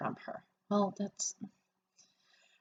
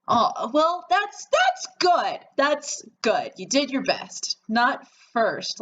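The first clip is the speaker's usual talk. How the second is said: forced reassurance; 7 semitones higher, slower than usual. Acted. Mother reassures daughter about bad exam.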